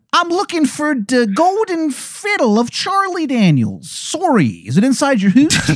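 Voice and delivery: very high, exaggerated bad Canadian accent; Angel of Canada, Canadian accent. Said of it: "it is a horrible Canadian accent".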